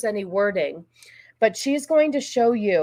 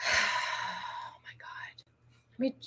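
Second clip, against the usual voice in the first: sighed, slow whisper of despair; exasperated whisper; 4 semitones higher, 11 dB quieter, much slower than usual. Real, not acted. Host giving up on the broken stream, slow whisper. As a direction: breathe it out, fed up. Stream keeps failing to load.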